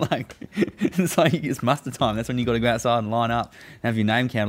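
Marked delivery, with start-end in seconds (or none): none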